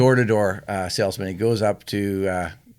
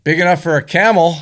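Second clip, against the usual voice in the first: high, loud punchline; raised voice, delivered big for the laugh; lightly voiced housewife; 7 semitones higher, 7 dB louder than usual. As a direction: pipe up as the customer, acted.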